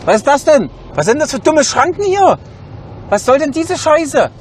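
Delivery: fuming at the stupid barriers; berserk rage. Real, not acted. Genervt über Schranken am Drive-in. (wütend und fassungslos)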